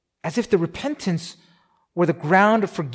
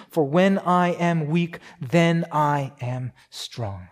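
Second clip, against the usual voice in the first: slow emphatic reading; much slower than usual. Shown as a demonstration. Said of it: "slowed scripture climax".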